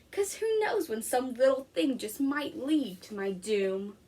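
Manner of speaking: lively picture-book read-aloud; reading to children. Acted. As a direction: read it to a class of young kids. Teacher reads picture book aloud.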